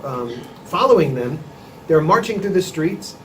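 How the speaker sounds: ordinary talk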